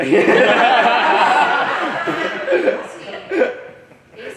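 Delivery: long loud teasing laughter. Real, not acted. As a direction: laugh hard at his denial. Laughs at Ace's reaction.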